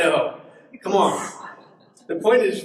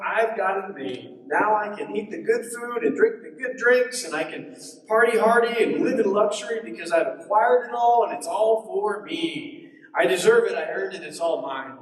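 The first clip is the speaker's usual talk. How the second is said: higher-pitched greedy fantasizer; self-congratulating gloat, pitched up; gloating rich farmer; 5 semitones higher than usual. Acted. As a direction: revel in it like a greedy villain. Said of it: "imagine this farmer fantasizing".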